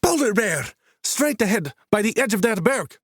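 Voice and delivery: high, thrilled guide calling out; excited shout. A narrator's reading. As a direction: call out the bear with delight. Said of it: "an excited shout from their guide".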